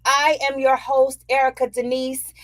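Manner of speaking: bright, loud welcome to listeners; show host intro. Real, not acted. Host greets listeners to open the episode, upbeat and loud. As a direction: open the show with high energy.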